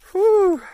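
loud relieved whew. Real, not acted. Relief after finding keys.

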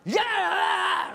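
high, loud hammed-up shout; a wife's high, loud comic yell at her son; mock yell. Wife yells at son.

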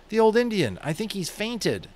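high, worried voice; frightened boy reporting the fainted chief; alarmed boy. A narrator's reading. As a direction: tell it with a boy's alarm. Omri alarmed over the chief.

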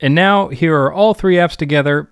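ordinary delivery

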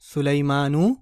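सुलेमानू with a clear long final ू; teaching demo. Shown as a demonstration. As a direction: voice the ending नू distinctly. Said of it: "हालत रफ़ा में क्या हो जाएगा". (सुलेमानू, "नू" साफ़)

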